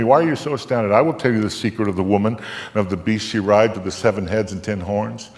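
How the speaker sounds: ordinary delivery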